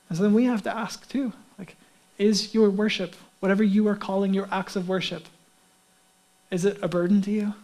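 normal talking voice

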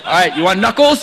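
loud, laughing boast after the push-up; proud laughing shout to the media team; laughing loud brag. Real, not acted. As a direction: crow about it through a laugh. Pastor celebrates his clap push-up.

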